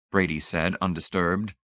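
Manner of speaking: ordinary delivery, nothing marked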